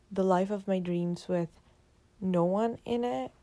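usual speaking voice

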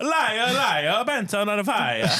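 put-on playful African-accent singsong; mock African accent. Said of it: "I'm gonna do the African accent".

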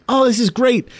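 high, bright mock delight; excited gush. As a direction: gush like a thrilled first-time viewer. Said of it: "being like".

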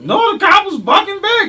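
high, loud shouted retelling; screaming. Real, not acted. Cohost yelling about the cop firing back, screaming. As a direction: shout it out in high-pitched excitement. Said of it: "Can you stop screaming".

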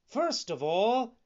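high, loud eager announcement; eager puppet. A narrator's reading. Puppet telling fox how he'll spend gold, eager. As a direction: launch brightly into grand plans. Pinocchio announces his grand plans.

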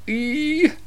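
a very high, soft embarrassed squeak; tiny high cringe noise; cringing squeak. Retold or imitated. Cringe after being caught.

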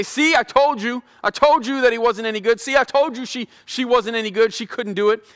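a hint of a sneering enemy; accusing Satan. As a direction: taunt like a smug adversary who says told you so. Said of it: "trying to say".

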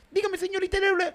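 very high-pitched, hammed-up foreign Spanish; catch-all Latin American accent. Shown as a demonstration. Improviser showing his one voice for Peru and Honduras, mock accent. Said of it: "todos los demás que sean Perú".

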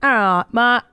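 lightly put-on English accent. Co-host echoing the comic, mock English accent.

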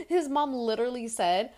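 usual speaking voice

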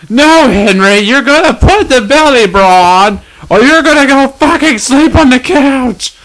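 high, sharp nagging wife; bossy wife. Acted. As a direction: order your husband around. Wife in the ad skit.

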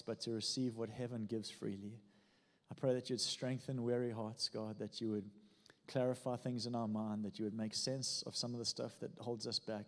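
soft, low spoken prayer; quiet prayer. Real, not acted. Pastor praying softly over the congregation. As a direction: pray it gently over the room.

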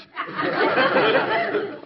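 a sudden high burst of laughter; husband bursts out laughing; amused laugh. Acted. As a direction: laugh out, caught off guard by the mistake. Husband laughs at tied thumb.

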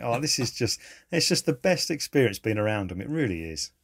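usual speaking voice

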